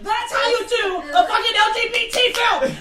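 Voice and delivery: high, loud triumphant cheer with laughter; elated shout. Retold or imitated. Viewer cheering when the title comes up, elated shout. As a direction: shout it out as a thrilled verdict.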